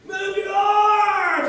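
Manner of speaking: high, very loud, drawn-out mock yell; mimicked parent yell. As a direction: imitate a sideline parent yelling at a kid. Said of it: "You know, parents yell at their kids".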